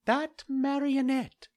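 a tiny insect scolding the doctors; talking cricket. Acted. Cricket continues.

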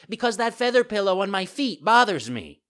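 high, loud puppet whine; fussy complaint. A narrator's reading. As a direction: complain like a spoilt child. Puppet makes excuses.

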